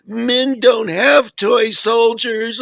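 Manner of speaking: high, loud, slow imitation of an outraged girlfriend; hammed-up scolding in her high voice; mock shrill girlfriend. Girlfriend voiced in retold story.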